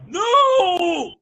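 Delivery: loud, high shout; yelled no. Acted. Spike yelling at the ponies. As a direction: bellow it.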